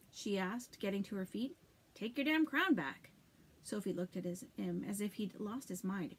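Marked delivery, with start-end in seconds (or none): 1.9-3.1 angry. A narrator's reading. Arno's angry demand.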